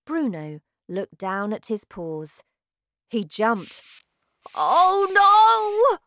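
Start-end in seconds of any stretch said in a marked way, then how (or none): 4.5-6.0 high, loud cry of dismay; shocked cry. Acted. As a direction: react to seeing his paws. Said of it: "he cried".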